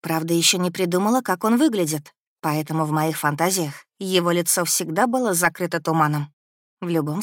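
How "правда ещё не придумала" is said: ordinary delivery, nothing marked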